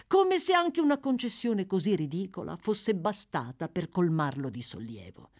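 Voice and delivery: ordinary delivery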